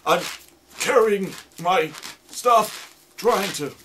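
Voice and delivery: high, slow, strained trudging voice; hammed-up effortful groan of a trudging actor; straining actor. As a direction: act out struggling through snow. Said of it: "they see these actors going".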